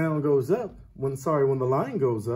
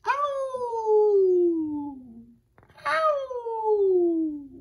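high, long wolf howl; 4 dB louder than usual. Shown as a demonstration. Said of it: "how does a wolf howl?".

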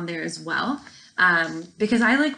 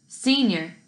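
ordinary delivery, nothing marked

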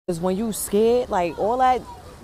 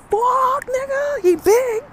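very high, loud cry of fear; frightened crying shriek; terrified; 6 dB louder, much slower than usual. Real, not acted. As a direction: squeal it out like you're terrified. Terrified reply about the demon.

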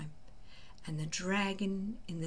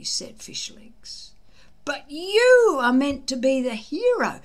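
put-on higher, louder earnest boyish voice; a loyal friend insisting on his hero; loyal Fishlegs; 7 semitones higher, 7 dB louder than usual. Fishlegs encouraging Hiccup he will be a hero, high earnest voice.

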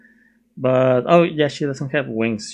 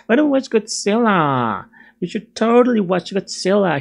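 put-on high Valley Boy accent; 7 semitones higher than usual. Vlogger re-enacting the movie suggestion, Valley Boy accent. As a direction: talk like an eager Valley Boy. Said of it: "Valley Boy accent".

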